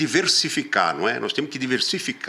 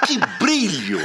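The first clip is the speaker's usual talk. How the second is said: mocking exclamation; 7 semitones higher, much slower than usual. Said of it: "Ele fala".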